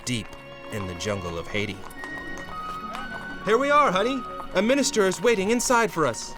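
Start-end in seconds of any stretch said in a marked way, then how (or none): 3.4-6.3 eager bridegroom. Acted. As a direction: usher your bride in excitedly. Comic hero addresses bride.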